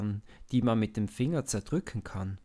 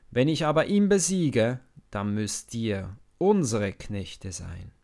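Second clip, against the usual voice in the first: Goliath's roar; 7 dB louder than usual. A narrator's reading. Goliath taunting the cowardly Israelites, roaring. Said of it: "Dann brüllt er".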